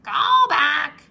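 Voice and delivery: high, loud parrot call. A narrator's reading. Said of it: "squawked Polly".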